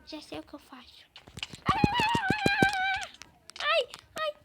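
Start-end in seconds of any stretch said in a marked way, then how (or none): 1.7-4.3 high, loud cry of pain; foot pain cry. Real, not acted. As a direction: yelp over a hurt foot. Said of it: "eu pulei de longe".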